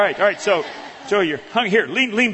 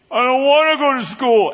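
put-on whiny kid; 8 semitones higher, 7 dB louder than usual. Son whining to mom.

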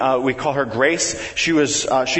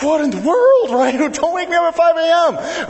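much higher, louder mock exasperation; groggy outrage; 12 semitones higher, 3 dB louder than usual. Dad retelling his son waking him at five, mock outraged. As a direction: act out being woken at dawn in disbelief. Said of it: "I'm like".

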